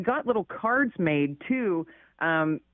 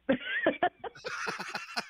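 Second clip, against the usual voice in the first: high bright laugh; 6 dB quieter than usual. Real, not acted. Laughs after brother's line.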